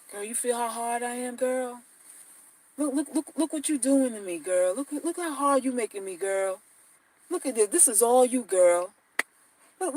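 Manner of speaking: soft, smooth pickup-line murmur; a hushed, hammed-up seducer working her; hushed smooth talker. Guy sweet-talking on third date.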